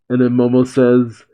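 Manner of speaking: ordinary delivery, nothing marked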